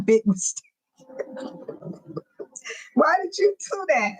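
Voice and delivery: high, laughing disbelief; amused, high-pitched teasing question. Real, not acted. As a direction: ask it through giggles. Laughing reaction to a DNA comment.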